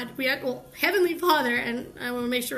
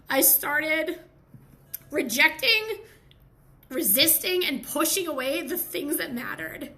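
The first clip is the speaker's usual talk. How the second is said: losing voice; 3 semitones higher, slower than usual. Real, not acted. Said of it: "Oh, I'm losing my voice".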